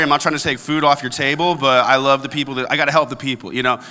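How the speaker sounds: normal talking voice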